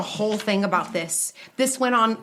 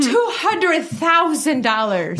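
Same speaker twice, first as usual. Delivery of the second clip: slow, laughing through the price; giggling at the shocking number; 5 semitones higher, slower than usual. Real, not acted. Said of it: "I can't even get it out".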